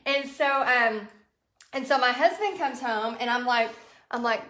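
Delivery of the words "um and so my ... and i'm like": usual speaking voice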